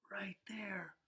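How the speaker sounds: hushed whisper; soft conspiratorial whisper. Acted. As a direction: breathe it out secretly. Pointing out the missing snake.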